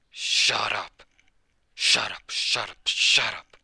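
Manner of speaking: very loud, repeated bellow; explosive yell, each one a separate burst; angry shouting. Acted. Drunkard taunted by a child as weak, angry shouting.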